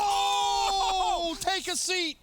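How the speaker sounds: high, loud caster yell; hype shout. Real, not acted. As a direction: bellow it at the big kill.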